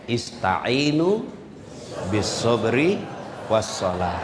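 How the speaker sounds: slow recitation of the verse; Quran recitation. Acted. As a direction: intone the verse slowly. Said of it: "Al-Quran bilang". (lantunan ayat yang lambat)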